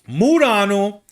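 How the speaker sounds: "Burano and Murano" said the Italian way, r lightly tapped; Italian pronunciation. Shown as a demonstration. Explaining the two Venice islands, names said with an Italian tapped r. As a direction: roll the names like an Italian would.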